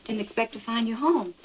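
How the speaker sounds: ordinary talk, nothing marked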